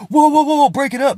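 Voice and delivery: high, loud imitated bystander calling out; mock-urgent shout to break up a fight; urgent intervening.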